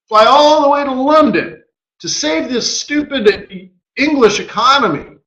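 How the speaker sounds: high-pitched exasperated mock rant; imitating a fed-up official, pitched well up; cursing central banker. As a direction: act out an incredulous, cursing outburst. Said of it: "Mark Carney was cursing".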